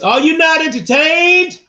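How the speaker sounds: high, loud, slow arena roar; booming gladiator challenge. Shown as a demonstration. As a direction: rouse the crowd like a gladiator. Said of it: "In my gladiator voice".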